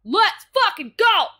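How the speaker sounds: loud high whooping call; hyped cheer. Real, not acted. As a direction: pump everyone up, shouting. Hyped cheer before memory restore.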